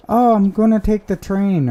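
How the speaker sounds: high, breezy fake excuse; mock scammer voice. Imitating the scammer's excuse about the train, high and breezy. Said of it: "scammer excuse, quoted".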